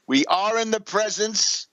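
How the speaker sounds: usual speaking voice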